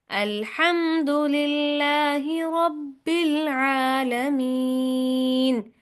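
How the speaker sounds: long, melodic chanting of अल्हम्दुलिल्लाहि रब्बिल आलमीन; slow Quran recitation. Shown as a demonstration. Teacher models the verse for students to repeat. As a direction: recite slowly, holding each vowel. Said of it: "दोहराएं, सुनाएं".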